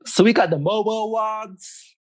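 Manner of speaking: British speaker trying out an American voice; American accent. Pretend. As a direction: do your best American accent. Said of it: "in your best American accent".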